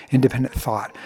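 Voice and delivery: usual speaking voice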